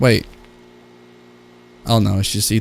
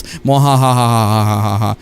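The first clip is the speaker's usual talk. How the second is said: evil laugh; 3 semitones lower than usual. Acted. Villain's letter, evil laugh.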